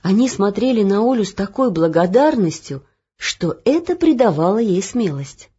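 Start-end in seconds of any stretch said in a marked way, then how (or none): none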